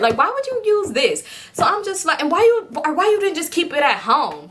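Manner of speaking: climbing outrage at her carelessness; exasperated rant. Real, not acted. Ranting about the friend who left her journal out, high and exasperated.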